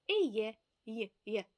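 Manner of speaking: «еє», then «є» twice, the sound shown on its own; sound demonstration. Shown as a demonstration. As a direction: model the Spanish sound «еє» for learners, then isolate «є». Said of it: "не може вимовити до цього часу оцей звук". (показ вимови звука «еє», потім окремо «є»)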